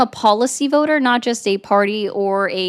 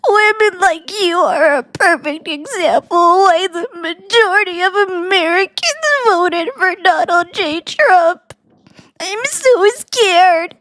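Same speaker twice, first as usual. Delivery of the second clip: high mocking whine, drawn out at the end; mocking whiny impression of Anon; 7 semitones higher, slower than usual. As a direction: parody the troll in a whiny high voice. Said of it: "this is Anon".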